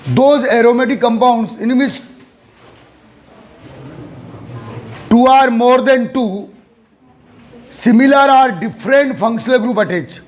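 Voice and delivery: slow, measured note dictation. Real, not acted. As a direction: dictate word by word for students writing it down.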